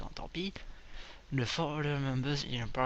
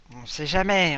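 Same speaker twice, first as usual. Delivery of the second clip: much louder, emphatic; loud exclamation; 3 semitones higher, 11 dB louder than usual. Real, not acted. Gamer loudly justifying buying spare parts. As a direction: belt out the caution.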